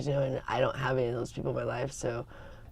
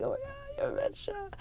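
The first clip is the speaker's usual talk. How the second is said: squealing much higher, thrilled to spot a Mets fan; excited fan gushing; 9 dB quieter than usual. Retold or imitated. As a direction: gush like a fan meeting another fan. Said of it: "I was like".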